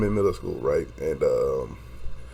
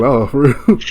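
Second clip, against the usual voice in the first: louder, higher, through laughter; laughing retold surprise; 4 semitones higher, 9 dB louder, slower than usual. Reacting to news of his busted lip, laughing surprise. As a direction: repeat the surprised question, cracking up.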